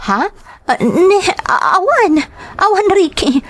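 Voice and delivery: high, teary stammering no; nervous tearful denial. Acted. Wife caught hiding something, denying to Ricky, teary and high. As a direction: deny it on the edge of tears. Wife denies secret to husband.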